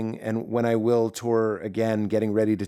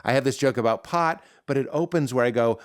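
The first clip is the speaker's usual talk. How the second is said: ordinary delivery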